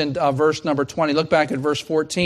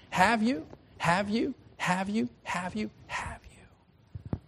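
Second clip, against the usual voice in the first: each have you softer until a whisper; fading repetition; 4 semitones higher, 8 dB quieter, slower than usual. Shown as a demonstration. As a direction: let the question die away to a breath. Repeated question fading out.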